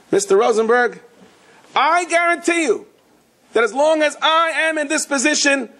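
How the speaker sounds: hammed-up pitchman promise; eager salesman. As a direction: oversell it like a smarmy banker. Banker making grand promises.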